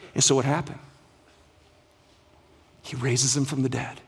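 ordinary talk

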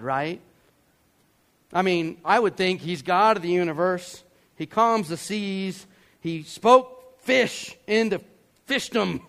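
ordinary talk, nothing marked

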